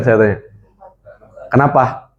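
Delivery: normal talking voice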